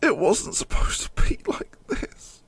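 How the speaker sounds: soft high whisper; devastated whisper. Real, not acted. Fan whispering his despair at the screen. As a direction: whisper it in disbelief.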